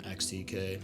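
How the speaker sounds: usual speaking voice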